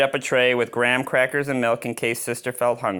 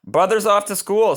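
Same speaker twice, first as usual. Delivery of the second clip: high, louder cheery announcement; cheerful papa; 10 semitones higher, 5 dB louder than usual. Acted. Papa telling sick Sister that Brother has left, cheerfully. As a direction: announce it brightly to the sick cub.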